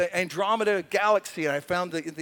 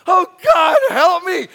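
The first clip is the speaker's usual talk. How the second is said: loud, higher crying out for help; desperate cry; 9 semitones higher, 11 dB louder than usual. Retold or imitated. David in the cave crying out to God, loud. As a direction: shout it like a desperate plea. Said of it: "Ever been like that?".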